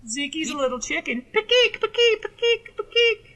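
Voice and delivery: very high, loud chicken squawks. Acted. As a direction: cluck like a taunting chicken. Mocking friend as chicken.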